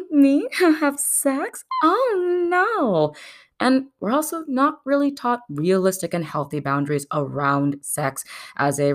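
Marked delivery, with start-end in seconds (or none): 0.1-3.1 very high, hammed-up prim shock; coy and demure. Woman supposed to deny sex, mock coy and demure. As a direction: act scandalised, high and girlish.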